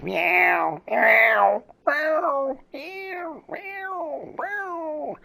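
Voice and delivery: high-pitched repeated kitty mews; imitated cat meowing. Man showing how his cat just meows, high mimicked mewing. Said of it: "where it just meows".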